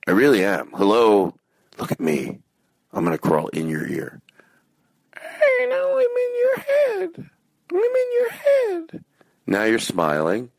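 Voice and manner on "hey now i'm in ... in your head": very high squeaky falsetto, drawn out; silly sustained falsetto; tiny head voice. Pretend. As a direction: squeal it slowly like a tiny creature inside the listener's head. Pretends to be inside listener's head.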